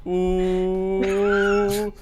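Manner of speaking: long, high ooh; audience ooh. Retold or imitated. Audience reacts to the wizard's love, mock ooh. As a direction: imitate a crowd's sustained ooh. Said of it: "everybody in the audience goes".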